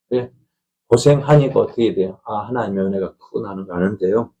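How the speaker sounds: ordinary talk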